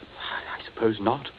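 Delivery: breathless scared whisper, barely voiced; hushed with fear, hardly any voice; frightened whisper. Cornered man whispers his fear to the killer.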